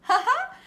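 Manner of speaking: sudden high giggle; bright squeal of a laugh; high burst of laughter. Real, not acted. Reacting to a viewer comment.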